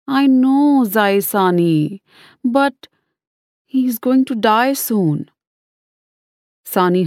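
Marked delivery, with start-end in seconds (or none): 0.1-5.3 put-on small boy, higher and slow; a child facing a loss, slowly; young boy voice. A narrator's reading. Child voiced in sad story.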